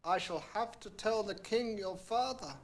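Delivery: a sly little threat; frog threatening. A narrator's reading. Frog warning the princess, lightly voiced threat. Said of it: "went on the frog".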